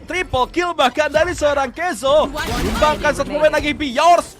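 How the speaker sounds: loud hype esports call; fighting game announcer. Real, not acted. Hyped live play-by-play.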